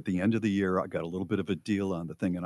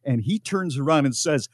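ordinary talk

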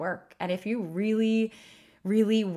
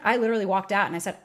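ordinary talk

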